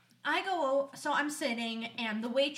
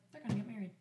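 softly voiced private thought; quiet realisation; 3 semitones lower, 6 dB quieter, much faster than usual. Friend looking at a posted picture, quiet realisation. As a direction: say it low, to yourself.

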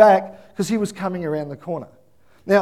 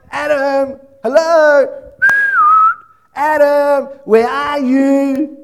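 high, loud call drawn out slowly; loud searching call, stretched long; 8 semitones higher, 8 dB louder, much slower than usual. Acted. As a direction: call across the garden for someone hiding. God calling, searching for Adam.

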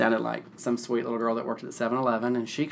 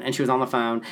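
ordinary talk, nothing marked

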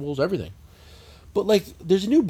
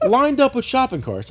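normal talking voice

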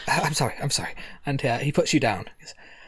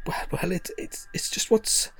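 nervous whisper; 4 semitones higher, 7 dB quieter than usual. Acted.

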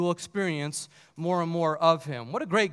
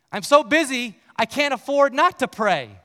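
preacher punches the punchline louder; emphatic repeat; 5 semitones higher, 6 dB louder than usual. Retold or imitated. Preacher repeating Luther's words to the congregation, louder and higher.

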